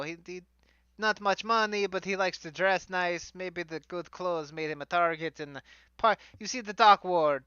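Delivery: hammed-up fantasy Italian accent; bad stage Italian for a fantasy character; fake Italian accent. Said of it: "bad, fake fantasy Italian accent".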